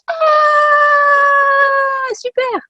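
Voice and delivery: long, high, loud squeal of delight; overjoyed high-pitched shriek; thrilled squeal. Retold or imitated. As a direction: draw out a very high excited cry. Acts out her delighted reaction.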